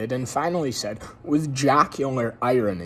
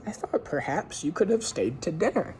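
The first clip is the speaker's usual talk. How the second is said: polite; 6 semitones higher than usual. A narrator's reading. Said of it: "the lady said politely".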